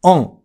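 オン as a throaty あ nasalised with the mouth left open; muffled, open-mouthed nasal オン; French nasal vowel. Shown as a demonstration. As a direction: say オン between アン and オン, from the back of the throat. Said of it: "口を開けたまま発音する美母音".

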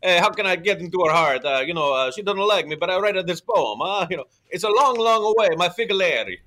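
high hammed-up Italian suitor; terrible Italian accent. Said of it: "upset with terrible accent work".

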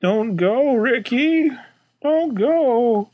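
high-pitched pleading fan, drawn out; mock begging. Fan begging the host Ricky not to leave, mock pleading. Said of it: "to beg him to stay".

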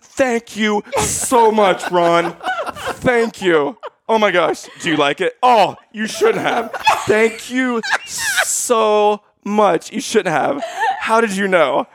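high, slow gushing gratitude; hammed-up gushing wife; over the top thanks. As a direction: overdo the thank-yous to the father. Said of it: "over the top".

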